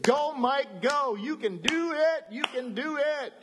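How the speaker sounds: God cheering Mike and the church from the sidelines. Acted. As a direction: cheer them on like a coach. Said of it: "He said".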